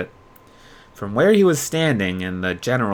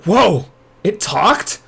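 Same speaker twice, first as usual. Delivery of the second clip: louder, higher startled gasp of words; startled exclaim; 7 semitones higher, 5 dB louder, slower than usual. A narrator's reading. Yuya exclaims, startled by the talking plush. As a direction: jump back in shock. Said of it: "Yuya exclaimed as he jumped back".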